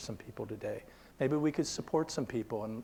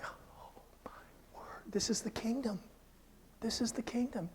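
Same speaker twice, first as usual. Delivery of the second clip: awed whisper; 8 semitones higher, 9 dB quieter than usual. Retold or imitated. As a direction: breathe it out in awe. Awed reaction to the scene.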